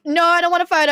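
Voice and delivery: retold firm no, said loud and high; sharp loud brush-off, half acted; loud refusal. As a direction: push him off with a loud firm no. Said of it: "I said that really loud".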